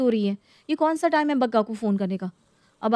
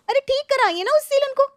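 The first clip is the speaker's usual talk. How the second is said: high, raised exasperated plea; 14 semitones higher than usual. Acted. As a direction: beg with frustration boiling over. Bahu pleading with mother-in-law. (ऊँची आवाज़ में झुंझलाकर गुहार)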